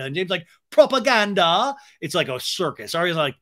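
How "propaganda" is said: high, loud incredulous echo; hammed-up scoffing echo; mock disbelief. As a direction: throw the word back in scorn.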